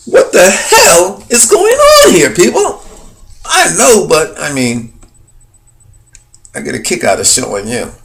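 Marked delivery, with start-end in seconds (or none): 0.1-2.8 loud, high, drawn-out disbelief; incredulous outburst. Real, not acted. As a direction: exclaim in real astonishment to the audience. Exclaiming at what he sees.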